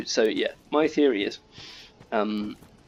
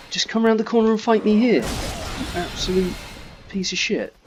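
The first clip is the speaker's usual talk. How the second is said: mock taunt; 10 semitones higher, 5 dB louder than usual. Taunting someone in a game.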